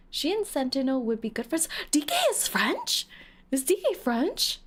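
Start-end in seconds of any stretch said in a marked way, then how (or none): 1.9-4.6 high-pitched astonished question; real surprise. Real, not acted. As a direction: leap up in pitch, questioning the news. Surprised by a chat claim.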